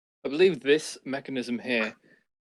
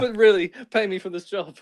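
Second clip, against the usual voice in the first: joking demand; 7 semitones higher, 4 dB louder than usual. Acted. Player joking demand to gnome.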